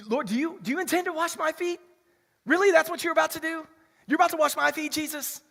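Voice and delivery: high, incredulous Peter asking Jesus; voicing Peter. Preacher acting Peter protesting to Jesus, high and incredulous. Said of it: "John thirteen six, Peter".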